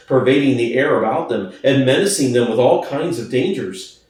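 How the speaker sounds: ordinary delivery, nothing marked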